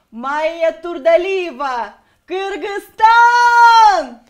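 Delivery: Kyrgyz accent pageant announcement, slow and loud. Shown as a demonstration. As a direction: Kyrgyz accent, shouted and drawn out. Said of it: "с кыргызским акцентом".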